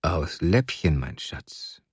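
low murmur fading to near-whisper; low, hushed endearment; coaxing older man. A narrator's reading. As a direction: coo it softly to the child. Man coaxes the little girl.

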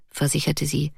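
ordinary delivery

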